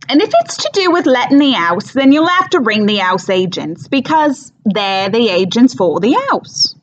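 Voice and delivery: overdone, silly stage Cockney with big swooping pitch; Mrs Clackett, thick Cockney. Acted. Housekeeper on the phone explaining everyone's in Spain, silly thick Cockney. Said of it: "Cockney accent, but, um, on stage it's very, very thick, overdone, and kind of silly".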